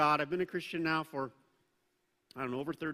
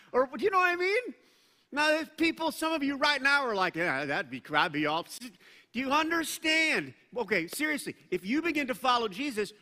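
much higher and louder, driving sermon; full-force preaching; 7 semitones higher, 6 dB louder than usual. Real, not acted. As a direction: preach it out to the whole room with force.

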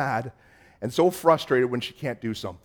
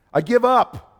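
a higher, louder cry of giving up; frustrated giving up; 9 semitones higher, 6 dB louder than usual. Retold or imitated. Pastor mimicking a churchgoer who quits in frustration, loud exasperated cry. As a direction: throw it out like a fed-up child quitting. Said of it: "get so angry".

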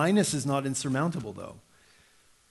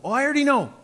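put-on much higher and louder child's huff; upset daughter; 11 semitones higher, 5 dB louder, faster than usual. Dad voicing daughter at dinner, high indignant whine. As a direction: act the indignant kid. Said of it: "She's getting all upset".

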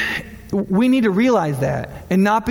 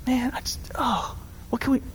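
near-whispered pity; soft sympathetic hush; 4 semitones higher, 8 dB quieter than usual. Retold or imitated. Pastor moved by single mom, hushed sympathy. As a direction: trail off softly in sympathy. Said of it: "And I'm just like".

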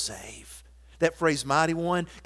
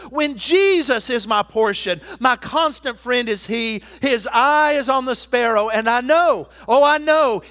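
hymn lines building louder to a cry of oh, I know; fervent hymn recitation; 7 semitones higher, 10 dB louder than usual. Acted. Pastor reciting an old hymn to the flock, building fervor.

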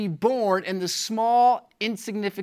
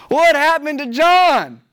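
louder, higher, insistent question; emphatic preaching cry; 5 semitones higher, 7 dB louder than usual. Real, not acted. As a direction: throw the question out to the congregation. Preacher repeats his question for emphasis.

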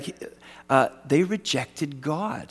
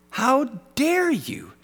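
much higher, slower mock indignation; pitched-up "How dare you" with a stretched "dare"; higher, hammed-up outrage; 10 semitones higher, slower than usual. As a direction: push "dare" up high and slow. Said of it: "I would have said this".

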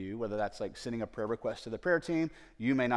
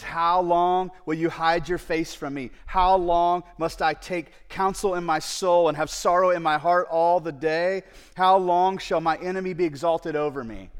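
lament; 5 semitones higher, 8 dB louder than usual. Retold or imitated. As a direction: stretch each how long like a plea. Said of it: "He says".

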